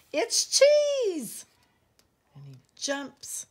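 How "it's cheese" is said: very high, loud squeal of discovery; excited shout. Acted. Pip thinking he has found cheese, excited shout. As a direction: cry out the find at top pitch.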